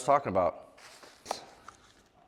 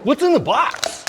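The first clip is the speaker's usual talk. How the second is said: movie quote shout; 10 dB louder than usual. Acted. Famous movie quote shouted at box.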